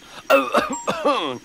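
high, spluttered cough covering a laugh; stifled-laugh cough. A narrator's reading. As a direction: cough to hide your amusement. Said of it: "trying not to laugh himself".

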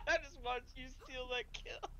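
high, helpless laughter through the words; tickled, squeaky laugh-talk; real laughing. Real, not acted. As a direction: squeeze the words out while cracking up. Laughing at friend's kill steal.

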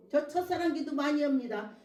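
normal talking voice

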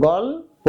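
"গল্প" lightly as "gol... po"; gently split syllables. Shown as a demonstration. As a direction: ease through "গল্প" in two parts, rounded ending. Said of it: "আলতো করে বলো তো". (আলতো করে "গল-পো")

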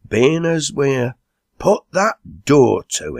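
slow, high-pitched instruction; calling an order. A narrator's reading. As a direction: break off and call across the room. Chairman breaks off to give an order.

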